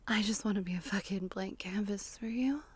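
breathy close-mic ASMR whisper; intimate whisper. Acted. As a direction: whisper it right into the listener's ear.

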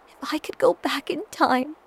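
breaking into a whisper. A narrator's reading. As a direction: let the voice crack and fade. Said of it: "My voice breaks".

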